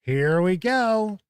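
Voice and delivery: normal talking voice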